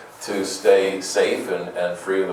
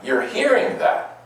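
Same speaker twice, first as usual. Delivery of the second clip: normal talking voice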